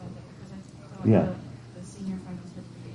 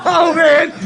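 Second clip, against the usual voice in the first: laughing exclamation; 5 dB louder than usual. Real, not acted. Laughing at student's joke.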